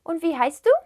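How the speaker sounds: "Und wie heißt du" lifting up on "du"; rising question intonation. Shown as a demonstration. Teacher demonstrates how a question sounds, voice rising. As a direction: let the voice climb at "du". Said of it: "my voice was rising".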